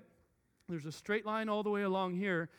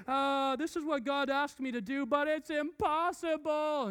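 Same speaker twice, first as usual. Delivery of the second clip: higher, slow whine; whiny complaint; 7 semitones higher than usual. Retold or imitated. A believer whining to God, slow and high. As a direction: complain like a child who gave up.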